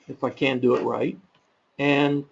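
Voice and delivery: ordinary delivery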